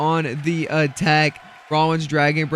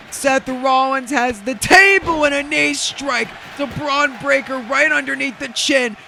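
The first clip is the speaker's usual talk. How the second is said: hyped play-by-play; 9 semitones higher than usual. Real, not acted. Commentator calling a near fall, excited and high.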